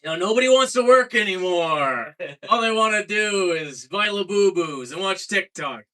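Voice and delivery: hammed-up news anchor voice. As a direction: play the cheerful TV host brushing it off. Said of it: "They're like".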